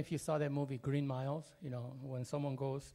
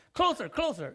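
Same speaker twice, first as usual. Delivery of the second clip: high, loud call across the room; father summoning his frightened son; calling closer; 9 semitones higher, 8 dB louder than usual. Retold or imitated. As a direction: call the boy over, insisting.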